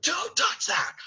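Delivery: very high, held-back scream; an intense yell reined in for the house; demonstrated scream. Shown as a demonstration. Director shows Beast's scream without frightening children, high strained yell. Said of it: "what I'm looking for".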